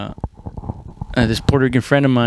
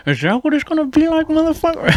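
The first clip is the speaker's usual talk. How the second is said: much higher, fast mocking taunt; taunting fighter; 13 semitones higher, faster than usual. Fighter taunting the guy who just hit him, high mock challenge. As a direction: squeak out the challenge like a cocky brawler.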